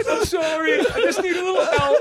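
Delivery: high whiny plea for help, laughing; shouted mock plea to mom and dad; mock begging. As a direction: beg your parents like a broke kid. Mock call begging parents.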